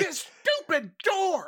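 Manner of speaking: put-on high yelling old-timer; old prospector. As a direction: yell at the stuck door. Old man NPC yelling.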